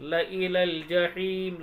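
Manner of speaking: slow "लइलल जहीम" with short first ल and long ही; unstretched लाम. Shown as a demonstration. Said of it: "इस तरीके से पढ़ते हैं". ("लइलल जहीम" में पहला ल छोटा, ही लंबा)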